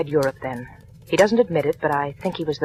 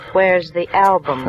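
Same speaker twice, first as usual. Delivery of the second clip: slower and louder, pressing the question; insistent repeat; 2 semitones higher, 4 dB louder, slower than usual. Acted. Marla pressing Harry for the album, slow and insistent. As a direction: lean on it, drawn out, demanding now.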